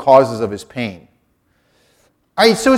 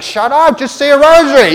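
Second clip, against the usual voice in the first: loud, high imitation of a woman in labor; mock exasperated yell; 10 semitones higher, 8 dB louder than usual. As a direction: yell at your husband, comically fed up. Sister in labor snaps at husband.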